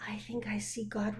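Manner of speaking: put-on child's awed hushed whisper; whispering in wonder at the sky. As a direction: breathe it like a secret. Child imagines God on cloud.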